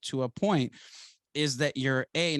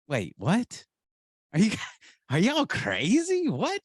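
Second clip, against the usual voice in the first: high-pitched, baffled reaction, acted out; incredulous disbelief; 7 semitones higher than usual. Speaker to people praising his radio voice, mock disbelief. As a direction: replay being utterly thrown, pitch leaping up.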